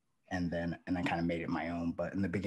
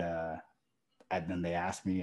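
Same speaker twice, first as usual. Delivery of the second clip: usual speaking voice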